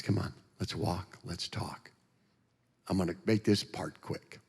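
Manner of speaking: very soft, calm invitation; hushed, unhurried and kind; gentle invitation. Retold or imitated. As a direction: softly coax someone to come along. Jesus voiced, inviting him along.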